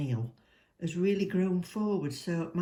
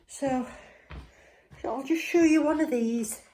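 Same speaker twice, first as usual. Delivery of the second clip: excited; 9 semitones higher, 7 dB louder than usual. Real, not acted. Excited showing nails.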